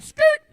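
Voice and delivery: very high, loud skrrt screech; a sharp tire-screech noise; skidding sound effect. Retold or imitated. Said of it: "he literally just went".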